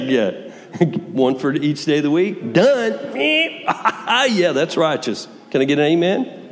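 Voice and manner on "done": high clipped "Done" breaking into a laugh; mock satisfied, then laugh. Preacher mocking Peter's seven-times limit, laughing. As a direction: declare it settled and laugh it off.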